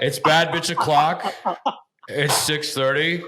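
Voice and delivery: mock news anchor reading song lyrics; lightly hammed-up anchor delivery, slowing down; Lester Holt. Host as newsman reading pop lyrics, mock anchor voice. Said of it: "Lester Holt reading lyrics off of".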